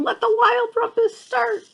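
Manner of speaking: excited cry. Acted. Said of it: "And he's saying".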